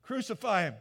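the mob demanding death; a crowd calling for crucifixion, loud; crowd shouting. Retold or imitated. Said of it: "He goes out to the crowd".

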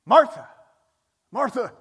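loud, emphatic double call; calling out the name. Shown as a demonstration. Showing how the name was repeated to draw attention, loud. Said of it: "They repeated the name".

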